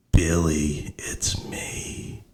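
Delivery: breathy ghostly whisper, no voice. Retold or imitated. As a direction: hiss the name like a ghost on the line. Dead aunt whispering on phone.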